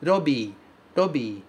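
"robi." with a tapped R and a long i; Japanese robii. Shown as a demonstration. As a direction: model "robi." with the flap and a long bi. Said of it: "Cuidado novamente com o R do começo". ("robi" com R batido e i longo)

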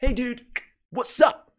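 lively acted "hey, man" greeting; greeting a buddy. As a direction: hail your friend like a dude. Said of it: "Quando você fala com teu amigo".

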